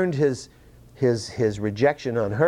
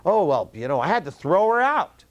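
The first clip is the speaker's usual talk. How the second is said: higher-pitched excuse in another man's mouth; mock self-justifying testimony; 6 semitones higher than usual. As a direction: act out his feigned, shrugging excuse. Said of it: "because he will testify".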